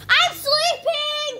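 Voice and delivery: slow loud whining wail; whiny cry. Real, not acted. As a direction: drag it out like a tantrum. Whiny protest, wants to sleep.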